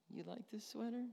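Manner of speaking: lightly flattered. Retold or imitated. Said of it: "made me feel like".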